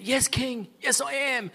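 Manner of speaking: a lightly voiced weak, high reply. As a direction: answer faintly from the dungeon. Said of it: "This weak voice comes out".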